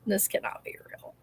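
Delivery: breathless awed whisper. Real, not acted. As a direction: whisper it in disbelief. Repeats the line in awe.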